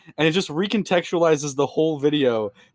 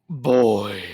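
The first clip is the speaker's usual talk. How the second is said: low gruff warrior growl; deep gruff Kratos voice; 7 semitones lower than usual. Pretend. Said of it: "Yes, that's very deep".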